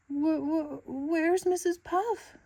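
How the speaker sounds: high, slow stammer of dismay; worried high stammer; stammering shock. Acted. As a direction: stutter out the question in alarm.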